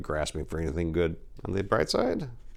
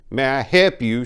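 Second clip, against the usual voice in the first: curt clerk; 6 semitones higher, 6 dB louder than usual. Acted. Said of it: "almost in a personal attack".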